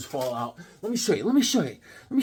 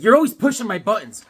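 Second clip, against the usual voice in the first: loud, higher mock scolding; acted-out raised-voice accusation; wife's complaint; 6 semitones higher, 7 dB louder than usual. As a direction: imitate an exasperated wife. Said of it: "she's like".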